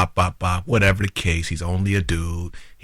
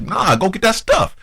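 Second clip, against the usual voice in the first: high, raised-voice retort; retold loud outburst; 10 semitones higher, 3 dB louder than usual. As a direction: act out an irritated man getting loud. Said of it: "So he got loud again".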